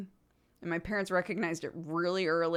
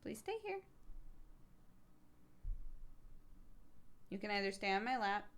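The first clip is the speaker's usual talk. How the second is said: much softer, gentle words to a pet; soft pet talk; 14 dB quieter, faster than usual. Real, not acted. Owner gently telling her pet to stay off the table, quiet. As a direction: coax the animal quietly off the table.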